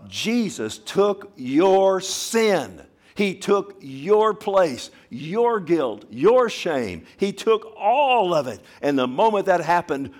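slow, high, loud preaching; preaching shout. Real, not acted. Preacher to the congregation, shouting the good news. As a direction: proclaim it with full force.

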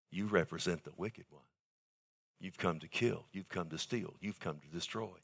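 quiet condemnation fading to almost nothing; hushed rebuke. Retold or imitated. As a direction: press the accusation down to a near hush, fading on destroy. Rebuking the wicked one.

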